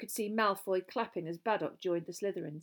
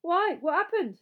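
mouth full; 10 semitones higher, 8 dB louder than usual. A narrator's reading. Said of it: "through a sizable chunk of steak".

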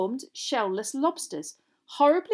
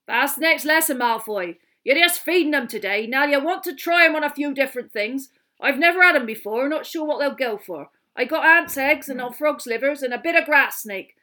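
loud, higher, gruff gamekeeper voice; rough; 5 semitones higher, 8 dB louder than usual. A narrator's reading. Hagrid answering Malfoy after a pause, roughly. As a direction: push through the explanation brusquely. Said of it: "then he said roughly".

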